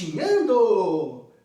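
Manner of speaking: "Gatinhando" stretched slowly and loudly; drawn-out emphasis. Shown as a demonstration. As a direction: draw out every syllable of "Gatinhando". Reveals the answer with playful emphasis.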